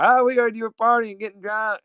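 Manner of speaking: high-pitched mocking imitation of gossips. Coworkers accusing him of partying, high mocking imitation. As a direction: parrot the accusers in a high mock voice. Mimicking coworkers' drunk rumor.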